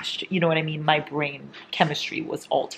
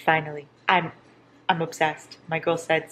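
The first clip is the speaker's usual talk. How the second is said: normal talking voice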